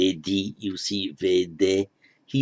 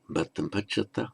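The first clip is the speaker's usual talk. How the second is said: "Bhattam pachata" said quieter; softer short form; 7 dB quieter than usual. Shown as a demonstration. Teacher giving the short form, softer. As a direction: let it drop softly. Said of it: "or just".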